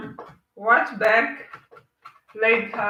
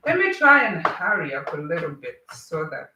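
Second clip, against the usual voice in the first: ordinary talk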